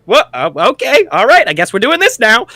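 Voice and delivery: a little gnome dangled up and down, giving in; flustered gnome. Acted. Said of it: "He's just like".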